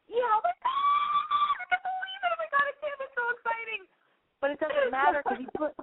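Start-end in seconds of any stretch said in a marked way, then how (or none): 0.1-3.8 high, giddy squealing over a text. Retold or imitated. Host mocking a caller's giddy reaction, squealing. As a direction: gush like a lovestruck fan. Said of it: "and you're probably like".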